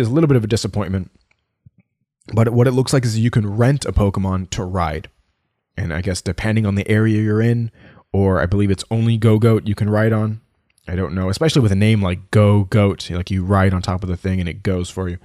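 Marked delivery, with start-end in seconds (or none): none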